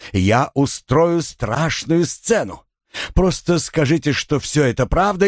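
theatrical ranting threats; overplayed melodramatic fury; hammy stage actor. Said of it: "театрально воскликнул он".

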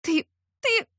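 high, halting speechless stammer; lips trembling. A narrator's reading. Heroine unable to get words out, high shocked stammer. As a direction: stammer upward, lost for words. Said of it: "У меня задрожали губы".

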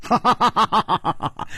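high, tinkling run of laughter; silvery laugh. A narrator's reading. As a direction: laugh like little silver bells. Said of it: "银铃般的笑道". (银铃般的笑声)

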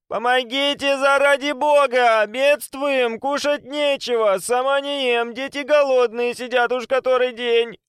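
high, slow, wailing plea; whining beggar woman. A narrator's reading. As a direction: whine like a pitiful beggar woman. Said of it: "женщина заныла".

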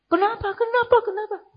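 very high-pitched panicked questioning; mock shriek. Parent seeing blood on child's hand, mock shriek. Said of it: "Abi atau Umi menjerit".

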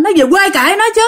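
a younger sister yelling at her friend, retold as a mock yell. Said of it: "nó mới la làng lên".

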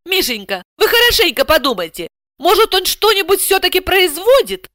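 high-pitched coaxing appeal; wheedle, begging for a better answer; pleading. A narrator's reading. Client coaxing translator to think harder, pleading. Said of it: "взмолилась она".

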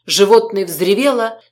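plain reading voice

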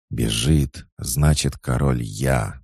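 usual speaking voice

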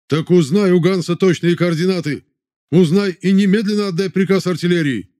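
high furious shouting. Acted. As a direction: yell the orders in a rage. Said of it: "закричал командир".

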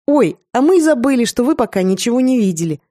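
high, loud happy chirp; cheerful chirping. A narrator's reading. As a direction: chirp it out gleefully. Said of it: "весело прощебетала Сандре".

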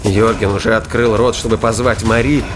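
usual speaking voice

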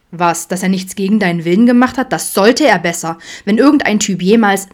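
loud, protective outburst; indignant. A narrator's reading. Father rages about boys pressuring his daughter, indignant. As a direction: build up into a rant until cut off.

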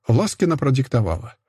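ordinary delivery

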